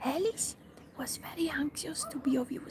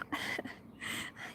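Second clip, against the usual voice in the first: breathy laugh; 6 dB quieter than usual. Real, not acted. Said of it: "I imagine".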